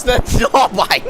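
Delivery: disbelieving laugh mid-phrase; laugh, lost for words; shocked laughter. Real, not acted.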